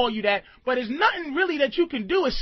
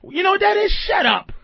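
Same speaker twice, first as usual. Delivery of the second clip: snapping; 6 semitones higher, 3 dB louder than usual. Shown as a demonstration. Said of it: "different inflections of bitch".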